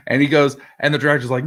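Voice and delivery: normal talking voice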